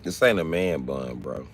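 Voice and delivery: normal talking voice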